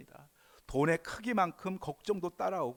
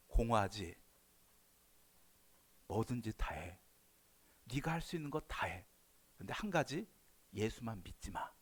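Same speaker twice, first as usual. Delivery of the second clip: lightly voiced tempting devil, lower; a sly devil coaxing, pitched down; devil's voice; 5 semitones lower, 3 dB quieter than usual. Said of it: "마귀가 이렇게 하는 거예요".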